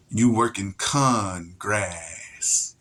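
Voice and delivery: "con grass" slow and low, split in two; wordplay. Shown as a demonstration. Driving the pun home to viewers, slow and low. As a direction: drawl "You working con grass" very slowly. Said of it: "That's how they speak".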